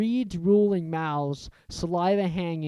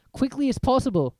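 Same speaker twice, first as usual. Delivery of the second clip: Ron; 8 semitones higher, 3 dB louder, faster than usual. A narrator's reading. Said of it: "said Ron".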